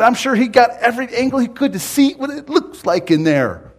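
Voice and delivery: high, loud preacher's voice; full-force preaching. Real, not acted. Preacher driving the story home to the congregation, full-force preaching.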